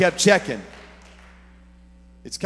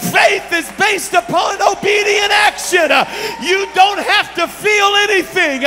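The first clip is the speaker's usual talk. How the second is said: loud, much higher shouted sermon; climbing into a loud, high shouting climax; full-force preaching; 11 semitones higher, 13 dB louder than usual. Real, not acted. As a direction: preach it at the top of your voice.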